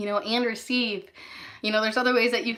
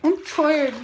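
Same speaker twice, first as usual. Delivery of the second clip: high, loud tired whine; whiny tired complaint; 6 semitones higher, 8 dB louder than usual. Real, not acted. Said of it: "I'm so tired".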